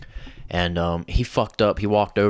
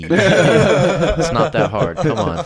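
laughing; 8 semitones higher, 6 dB louder, much slower than usual. Retold or imitated. Teasing the owner, laughing.

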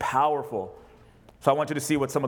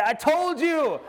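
higher, teasing gotcha; lightly voiced smug Jesus; mock I-told-you; 11 semitones higher, much slower than usual. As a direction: gloat playfully. Imagined Jesus gloating.

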